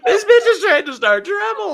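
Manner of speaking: laughing. Acted. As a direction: crack up while accusing her. Repeats the accusation, laughing hard.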